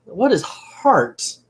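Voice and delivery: slow, high-pitched incredulous question; baffled disbelief. Real, not acted. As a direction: draw out the question in real bafflement. Baffled reaction to the book.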